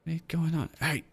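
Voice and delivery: alarmed cultist. Acted. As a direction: demand answers, alarmed. Cultist demands answers.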